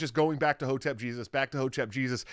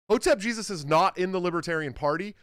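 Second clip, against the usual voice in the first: ordinary talk, nothing marked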